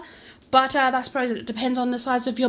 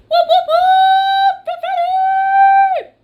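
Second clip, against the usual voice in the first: much higher, much louder, slow whooping shout; hyped cheer; 10 dB louder, much slower than usual. Pretend. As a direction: cheer it like a game show host. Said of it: "hypes her show segment".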